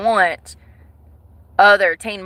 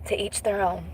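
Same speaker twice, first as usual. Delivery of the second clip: soft unvoiced whisper; whispered to each their own; whispered aside; 2 semitones lower, 14 dB quieter than usual. Real, not acted. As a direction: whisper it like a shrugging secret. Quiet resigned closing remark.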